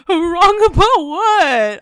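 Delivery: high, loud wail, very stretched; mock crying. Juliet answering his apology, hammed-up crying. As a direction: howl the question in fake sobs. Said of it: "so she's crying".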